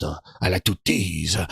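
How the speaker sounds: mock French accent, cagey, ending in a stretched shout. Streamer mocks Herman's French teasing, hammed-up French accent. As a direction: lean into a hammed-up French tease. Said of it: "Herman turns his French on".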